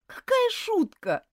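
put-on a girl's indignant cry. A narrator's reading. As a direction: fire back, offended. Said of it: "рассердилась Элизабет".

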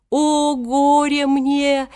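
high, slow theatrical lament; old genie's hammed-up cry of woe; wailing. A narrator's reading. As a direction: wail woefully, stretching every vowel. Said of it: "вопил при этом старик Хаттабыч".

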